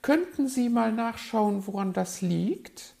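high, loud bird lady; put-on high voice, asking; magpie character voice. A narrator's reading. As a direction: pipe it up high as a pleading magpie. Magpie's plea to the fox.

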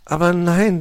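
put-on loud, high, merry young fox; cheerful. A narrator's reading. Nephew reassures uncle about the knife, merrily. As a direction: call it out gleefully. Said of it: "lachte Weißohr fröhlich".

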